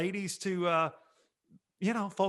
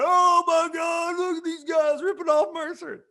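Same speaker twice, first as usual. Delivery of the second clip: mock excited shout; 11 semitones higher, 5 dB louder than usual. Imagined fan shouting at spotting the bit. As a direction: shriek it like a thrilled fan.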